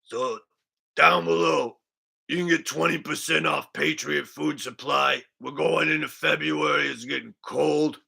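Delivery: lightly voiced laid-back vlogger plug; a mild imitation of a vlogger reading his sponsor plug; Richie from Boston. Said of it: "updated Richie from Boston impression".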